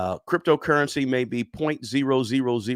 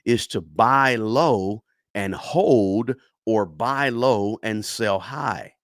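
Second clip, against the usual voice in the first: slow spelled-out rule; much slower than usual. Shown as a demonstration. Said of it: "The fundamental principle".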